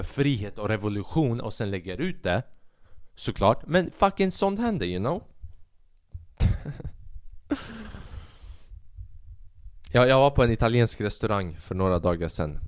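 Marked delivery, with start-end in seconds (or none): 6.4-8.2 breathy high chuckle; a short wordless laugh to oneself. Real, not acted. Podcaster amused after own rant, breathy chuckle.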